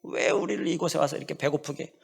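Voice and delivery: soft whiny grumble, voice changed; mock the grumbling people, hushed and sulky; complaining Israelites. Preacher mimicking hungry Israelites' complaint, softly whining. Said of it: "불평불만을 많이 했잖아요".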